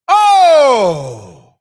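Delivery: a high, loud surprised shout. Real, not acted. Vlogger blurting out mid-laugh, loud.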